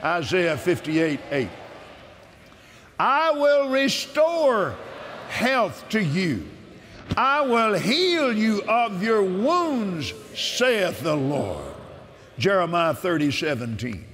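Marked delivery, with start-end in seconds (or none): 3.0-11.7 slow, solemn reading of God's promises; measured devotional scripture reading; scripture proclamation. Acted. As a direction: proclaim each verse as a promise. Reads healing scriptures aloud.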